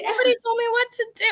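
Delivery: high, fast whine; whiny high complaint. Retold or imitated. Voicing a lost spy.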